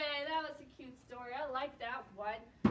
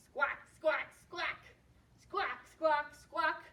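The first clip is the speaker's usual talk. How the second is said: short, loud squawks; penguin squawk; 3 semitones higher, 6 dB louder, slower than usual. Shown as a demonstration. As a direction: squawk in threes for children to copy. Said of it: "Can you squawk like a penguin".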